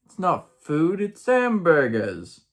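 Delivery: high, drawn-out mock British; mock British accent. Missionary protesting to his district leader, mock British accent. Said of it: "said with a mock British accent".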